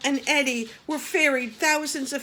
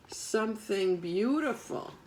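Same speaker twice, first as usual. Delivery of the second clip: ordinary delivery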